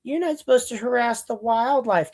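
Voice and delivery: high, mocking busybody voice; squeaky scolding impression of a nosy man; mock-whiny lecture; mimicking a scold. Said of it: "He's like".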